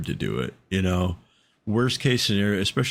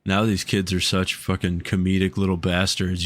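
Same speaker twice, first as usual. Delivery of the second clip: usual speaking voice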